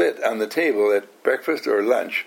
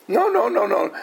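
higher, hasty no-no-no; flustered Ellen; 6 semitones higher than usual. Retold or imitated. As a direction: backpedal quickly like a flustered passenger. Ellen's flustered reply voiced.

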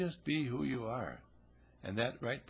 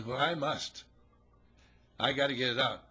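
insistent; 3 semitones higher, 5 dB louder than usual. Acted. Nun insisting on confessing.

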